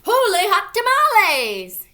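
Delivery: high, booming cry of amazement; big hammed-up outburst; loud exclamation. A narrator's reading. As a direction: exclaim it at full voice, stretching the end. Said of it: "exclaimed Skippy John Jones".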